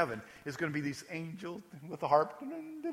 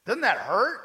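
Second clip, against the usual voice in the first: ordinary talk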